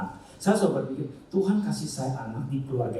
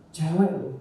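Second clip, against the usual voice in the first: normal talking voice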